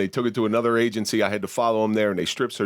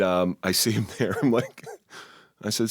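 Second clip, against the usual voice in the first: ordinary delivery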